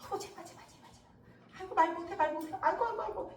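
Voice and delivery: hushed start rising into mock moans. Onlookers ridiculing Songgi who cannot speak, mocking. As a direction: jeer with little repeated mock pleas. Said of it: "웃어댔다는 건 비웃는 거야".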